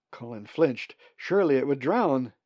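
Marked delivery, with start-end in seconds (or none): none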